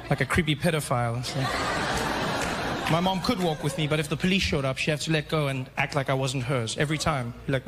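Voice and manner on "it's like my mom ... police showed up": normal talking voice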